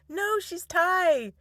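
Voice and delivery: high, slow, drawn-out correction; exasperated correction. Retold or imitated. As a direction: stretch it out as mock exasperation. Re-enacts correcting her husband.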